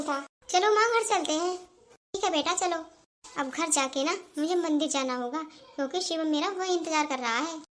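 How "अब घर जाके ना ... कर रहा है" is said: soft, low secretive aside; hushed confession to herself; quiet aside. Acted. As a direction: murmur it to yourself so mother can't hear.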